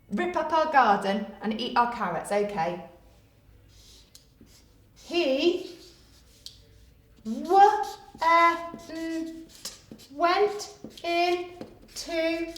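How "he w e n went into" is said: slow, drawn-out phonics sounding of went. Shown as a demonstration. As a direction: sound out each letter, stretching it. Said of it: "sounding out spelling".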